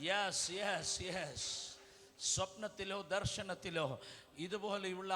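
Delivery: soft altar-call murmur over music; hushed prophetic prayer. Acted. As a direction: draw the crowd in gently before prophesying.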